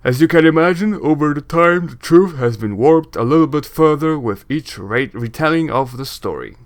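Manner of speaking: projected, blustery lore-teller; booming voice. Acted. Braskin shares rumors about the box, booming. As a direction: spin out rumors in a big booming voice. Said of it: "doing the booming voice".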